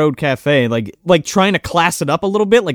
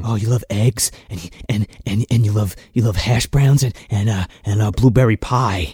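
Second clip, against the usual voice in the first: mock creepy low voice; 8 semitones lower than usual. Podcaster mocks the hero coaxing her memory in a low creepy voice. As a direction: list her favourites in a low creepy coax.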